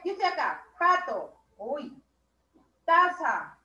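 ordinary talk

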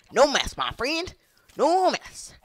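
high, loud, drawn-out call; stretched-out high taunt; playful holler. Real, not acted. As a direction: holler it slowly at a high pitch. Same catchphrase called out loud.

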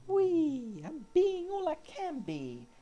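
high, slow put-on heroic voice; sing-song the army slogan like a gung-ho soldier; proud jeep voice. Player voicing his little jeep as a proud soldier.

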